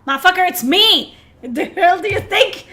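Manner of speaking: loud, high yell, laughing into the end; indignant yell. Real, not acted. Streamer yells at the game character who ignored him, a loud laughing shout.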